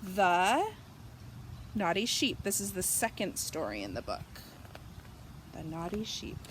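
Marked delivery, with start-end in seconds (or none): none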